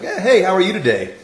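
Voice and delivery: high-pitched, chipper hello, retold with a laugh; cheery greeting. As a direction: greet the server with playful extra cheer. Retelling a surprisingly friendly drive-through greeting.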